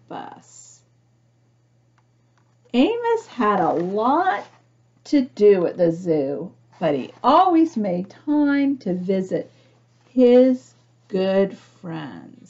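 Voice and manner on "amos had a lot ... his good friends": put-on gentle read-aloud narration for children; storybook reading. A narrator's reading. Reading picture book aloud.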